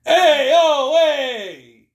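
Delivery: loud, high New York wiseguy brag; hammed-up New Yorker, punching the hey and oh; New York accent. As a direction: shout it like a cartoon New Yorker. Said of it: "talking with a New York accent".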